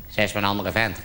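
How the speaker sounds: low, quiet voice of doubt; little inner voice. Acted. Nagging voice in a jealous man's head, low and quiet. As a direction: drop down low and soft, insinuating. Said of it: "zo'n stemmetje in je achterhoofd".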